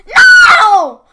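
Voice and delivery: put-on very high, loud defiant child's shout. A narrator's reading. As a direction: scream no at the witch. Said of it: "Gretel shouted".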